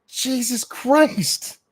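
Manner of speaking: very high, very slow exasperated groan of a curse; drawn-out high exasperated curse. Real, not acted. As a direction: drag it out in disbelief. Exasperated at guest.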